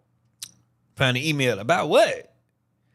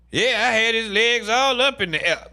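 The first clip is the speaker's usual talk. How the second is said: high, louder bragging voice; lightly put-on tattler voice; a hint of a bragging gossip; mock gossiping woman; 10 semitones higher, 4 dB louder than usual.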